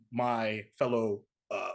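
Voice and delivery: usual speaking voice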